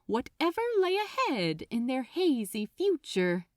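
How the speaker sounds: usual speaking voice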